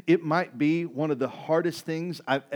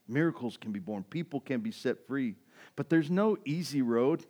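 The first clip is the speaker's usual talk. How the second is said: ordinary talk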